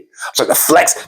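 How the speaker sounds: ordinary talk, nothing marked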